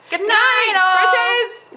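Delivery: high, loud cheerful goodbye; cheery sign-off. Real, not acted. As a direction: sing out the goodbye.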